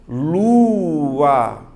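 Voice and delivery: "lua" loudly drawn out as lu-a, each vowel held; syllable separation. Shown as a demonstration. Teacher shows students how to separate the hiatus, "lua" stretched syllable by syllable. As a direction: stretch "lua" into two long, loud syllables, lu-a. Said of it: "tem que saber separar".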